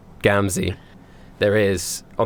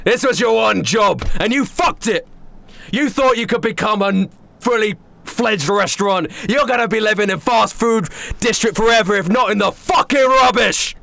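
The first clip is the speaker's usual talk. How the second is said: high, loud berating rant; furious chef; 11 semitones higher, 3 dB louder than usual. Acted. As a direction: tear into the clown for failing.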